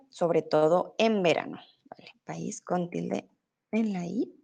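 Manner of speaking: words barely voiced, breath only; voice giving out. Real, not acted. Teacher reading a student's answer as her voice fades to a whisper. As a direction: voice slipping into a real whisper. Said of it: "mi voz está un poco extraña hoy".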